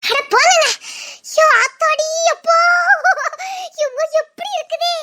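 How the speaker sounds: giddy with wonder; delighted. Acted. Delighted by the ride.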